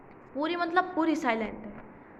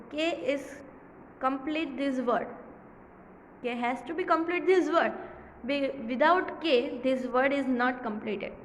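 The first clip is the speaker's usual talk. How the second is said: slow emphasis; much slower than usual. Shown as a demonstration. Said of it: "slow emphatic explanation".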